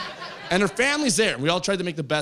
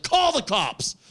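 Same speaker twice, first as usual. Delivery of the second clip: high, slow, indignant plea; mock victim outcry; 7 semitones higher, slower than usual. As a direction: cry bullying, drawing the words out. Plays victim to security, retold.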